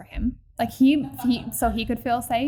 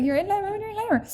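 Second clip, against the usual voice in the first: insistent; 10 semitones higher, faster than usual. Retold or imitated. Insisting she is in labor.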